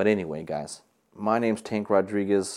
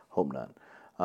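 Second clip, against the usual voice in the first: laughing aside; 7 semitones lower, 5 dB quieter than usual. Real, not acted. Joking about a toilet seat.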